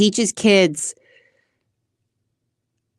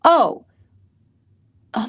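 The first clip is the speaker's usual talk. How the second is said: ordinary delivery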